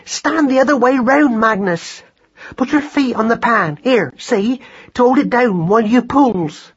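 high-pitched, exasperated orders; frantic mother giving directions; urgent instructing. Acted. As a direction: boss your son urgently, pitched high.

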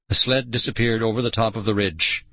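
usual speaking voice

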